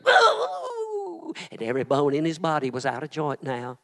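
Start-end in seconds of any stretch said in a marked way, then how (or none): 0.1-1.3 high groan of agony; cry of pain. Acted. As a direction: cry out as the cross drops. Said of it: "I heard him go".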